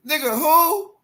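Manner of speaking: high, loud incredulous "who?"; outraged high-pitched who; shocked disbelief. Real, not acted. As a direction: squeal out the question in disbelief. Mock disbelief at rumor.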